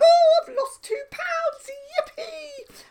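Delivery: high, loud, slow mock delight; mock cheer. Cheering the scale's water-weight loss, mock delighted. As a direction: ham up a fake celebration.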